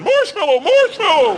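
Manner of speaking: high, loud mock-terrified yell; panicked cry. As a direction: scream the safe word for rescue. Panicked safe word while being mauled.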